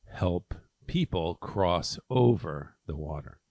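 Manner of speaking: usual speaking voice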